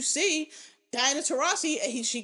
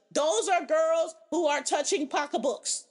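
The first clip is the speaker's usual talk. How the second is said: ordinary delivery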